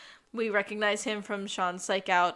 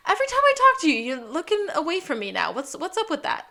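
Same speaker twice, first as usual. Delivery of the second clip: much higher and louder, mock hurt; high suspicious whine; 7 semitones higher, 5 dB louder, faster than usual. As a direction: whine up high about being ignored. [name] confronts the avoiding Gus.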